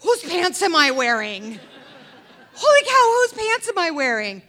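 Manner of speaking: a shrieking high holy cow through laughter; near-scream of delighted disbelief; laughing panic. Retold or imitated. Realizes the pants are Eddie's.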